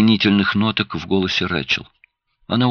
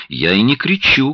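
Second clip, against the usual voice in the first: higher, mildly raised voice; shouting back; 6 semitones higher, 3 dB louder than usual. A narrator's reading. Husband denies to his wife that he is yelling, in a mild shout back. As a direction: snap back in a restrained shout. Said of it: "крикнул Луис в ответ".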